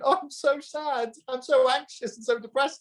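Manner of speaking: high mocking whiny voice; hammed-up moaning impression; mocking whiners. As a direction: parody the self-pitying complainers. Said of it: "everybody's coming to me going".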